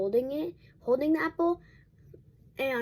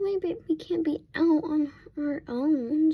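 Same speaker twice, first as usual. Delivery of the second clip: higher, louder and slow protective coo; doting over the dog; 6 semitones higher, 7 dB louder, slower than usual. Retold or imitated. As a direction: fuss over the dog as if she were a baby. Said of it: "because I was like".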